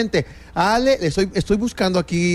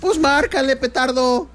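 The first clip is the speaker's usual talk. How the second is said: 1 mock exasperated shout; 10 semitones higher than usual. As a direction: snap it out like an annoyed husband. (grito burlón de marido desesperado)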